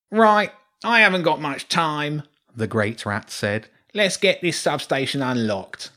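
high, brisk, self-important rat; pompous rat lord. A narrator's reading. Huge rat lord impatient with his soldiers. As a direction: hurry them along like a fussy lord.

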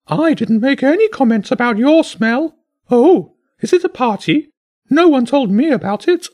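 very high, loud squawking bird, miffed then curious; fussy bird voice. A narrator's reading. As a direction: chatter like a put-out parrot. The bishop bird speaks.

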